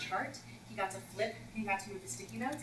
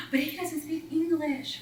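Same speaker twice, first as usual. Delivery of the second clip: put-on shocked kids; 7 semitones higher, 4 dB louder, slower than usual. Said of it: "audible gasp".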